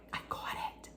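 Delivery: urgent hushed whisper, barely any voice. Retold or imitated. Whispering to her friend Tara that she caught the ball. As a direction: breathe it so nobody nearby hears.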